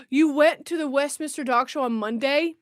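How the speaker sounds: usual speaking voice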